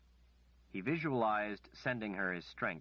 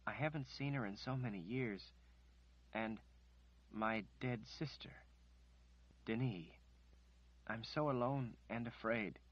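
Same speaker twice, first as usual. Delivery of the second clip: tearful; 3 semitones higher, 5 dB quieter than usual. A narrator's reading. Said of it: "she sobbed".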